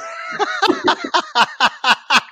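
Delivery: high, long burst of laughter; big laugh. Real, not acted. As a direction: crack up at the memory. Laughing at his own story.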